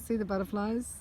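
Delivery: ordinary delivery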